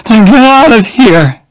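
high, loud, sharp dismissal; angry. A narrator's reading. Jenny angrily ordering Oliver away, high and loud. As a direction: throw him out in anger. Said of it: "she said angrily".

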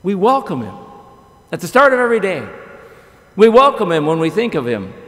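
ordinary talk, nothing marked

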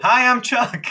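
high, loud, chirpy self-introduction; talking animal voice. Acted. Player voicing the undead dog introducing itself, high and chirpy. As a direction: bounce in like an eager little pet. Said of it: "what's your friend's name here".

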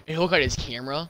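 normal talking voice

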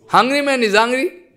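normal talking voice

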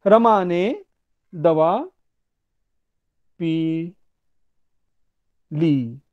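रमा ने, दवा, पी and ली each set apart by long pauses; pauses between words. Shown as a demonstration. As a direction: dictate slowly, पी and ली as two separate words. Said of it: "तो मैं कुछ वाक्य कहूंगा". (शब्दों के बीच लंबा विराम)

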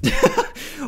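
high startled giggle; short high laugh; squeaky laugh. Real, not acted. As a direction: burst out in a surprised squeak. Sudden laugh at surprise.

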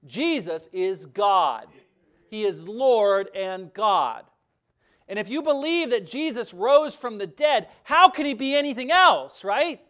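forceful preaching. Real, not acted. Preacher's rising climax.

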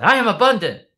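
loud, high re-enacted call; high-energy rallying yell; yelled affirmation prompt. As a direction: shout it for a child to echo. Dad calls out affirmation to daughter.

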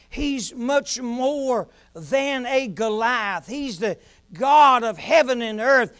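loud, ringing sermon climax; preaching at full force. Acted. Preacher lifting the congregation to a shout. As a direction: preach it out to the whole church.

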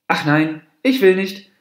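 high, loud, stubborn no; petulant refusal. A narrator's reading. Monkey turns down the deer's request, petulantly.

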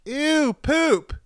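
put-on very high, loud kid holler; mock ten-year-old yelling. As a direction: imitate a grossed-out child shouting from a car.

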